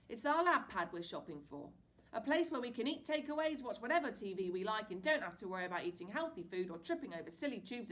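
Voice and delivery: cheery Dad. A narrator's reading. Dad to his boys in van, loud jolly pitch. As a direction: sell the fun afternoon.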